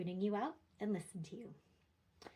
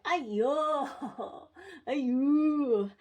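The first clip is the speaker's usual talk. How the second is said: loud, higher, whole-body Ay yo; dramatic full-body exclamation; 6 semitones higher, 10 dB louder, much slower than usual. Shown as a demonstration. Parent showing a big dramatic Chinese exclamation, loud. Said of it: "I put my whole body into it".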